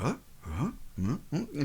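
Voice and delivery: soft questioning murmurs; quiet crowd murmur. Acted. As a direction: murmur puzzled little sounds, very softly. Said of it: "very quietly to start off with".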